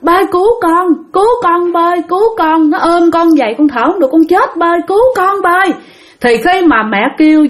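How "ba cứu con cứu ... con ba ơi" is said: imitating high, pleading calls to father for rescue; acted desperate cry. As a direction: beg for help in a raised, frightened voice. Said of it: "cái mẹ kêu lên liền".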